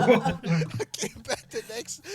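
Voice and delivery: laughing. Real, not acted. Cracking up mid-story.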